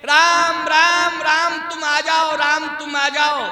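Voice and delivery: loud, slow, drawn-out call to Ram; dying Dasharatha's cry. Acted. Preacher voices dying king calling Ram, long loud cry. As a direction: call out high, stretching each Ram. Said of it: "Dasharath जी महाराज मर रहे हैं, कह रहे हैं कि".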